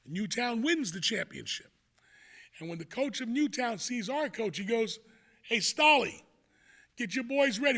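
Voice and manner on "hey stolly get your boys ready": loud, higher call to a rival; taunting call-out. Retold or imitated. Rival coach calls out to our coach, taunting. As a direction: imitate a cocky coach calling out.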